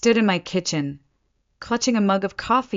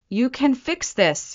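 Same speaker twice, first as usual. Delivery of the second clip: shouting; 7 semitones higher than usual. A narrator's reading. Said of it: "he shouted suddenly".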